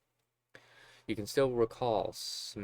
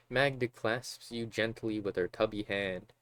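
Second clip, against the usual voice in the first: ordinary talk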